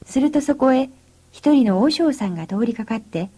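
plain reading voice

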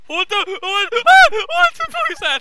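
shrieking high in terror; scared horror game streamer. Real, not acted. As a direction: scream out in fright. Monster appears on screen.

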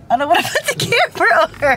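giggly high protest; laughing. Real, not acted. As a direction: try to talk while laughing at the stranger. Giggling about the woman crying.

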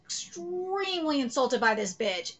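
normal talking voice

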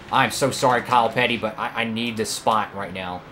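usual speaking voice

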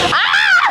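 high scream while falling. Real, not acted. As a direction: let out a shrill scream of fright. Screaming on the zipline ride. (yüksek korku çığlığı)

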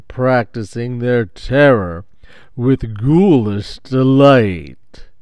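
slow, loud, eerie haunted narrator; spooky ghost host. Retold or imitated. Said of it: "Those are the opening words".

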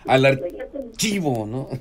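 exaggerated imitation of an annoyed woman; mock angry reply. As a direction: ham up the caller's indignant answer. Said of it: "Pues yo ya enojada dije".

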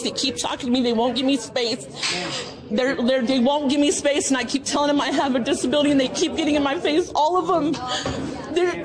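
starts level, then rises into real agitation; calm turning angry. Real, not acted. Agitated phone call for help.

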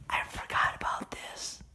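hushed whisper to himself. Real, not acted. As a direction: breathe it out under your breath, exploring. Whispering while exploring house.

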